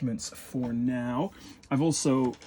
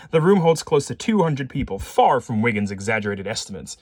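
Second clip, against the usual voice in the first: louder, punched-up reading; emphatic narration; 7 dB louder than usual. A narrator's reading. Player re-reading a line after laughing, emphatic narration. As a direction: read it out with pointed emphasis. Re-reads game text aloud.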